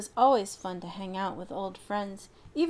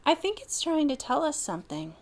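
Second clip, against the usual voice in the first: higher, curious; a hint of cow voice; 7 semitones higher than usual. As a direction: lean in and wonder. Alexis voiced.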